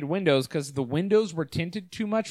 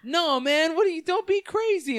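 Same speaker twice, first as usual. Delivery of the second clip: much higher, squeaky quick denial; high defensive denial; 12 semitones higher than usual. Retold or imitated. Drug dealer caught talking to a doll, high squeaky denial. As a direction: squeak it out, caught red-handed. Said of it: "And he's like".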